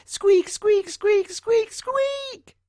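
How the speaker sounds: very high, loud squeaks; loudest mouse squeak. Acted. John Mouse squeaking as loud as he can at the cat. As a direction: squeak at the top of your squeak. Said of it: "squeaked at the top of his squeak".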